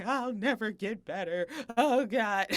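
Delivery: loud, high hammed-up wail; mock despair. Retells falling apart, part four.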